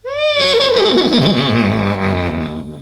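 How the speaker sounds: loud, high laugh, savouring the king's fall; gleeful laugh. Acted. Horse laughing at the fallen king, gleeful laugh.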